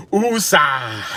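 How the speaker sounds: a light calming woosah from the movie; Bad Boys woosah. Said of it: "like they say on Bad Boys".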